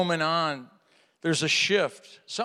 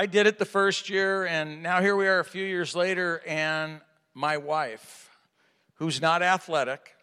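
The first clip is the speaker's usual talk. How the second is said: ordinary delivery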